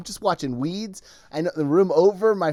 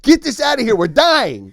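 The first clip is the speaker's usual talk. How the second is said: higher, louder mock panic; stomach's panic; 9 semitones higher, 7 dB louder than usual. Voicing his own stomach, mock-panicked and louder. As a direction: play the stomach in a hammed-up alarm. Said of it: "My stomach is like".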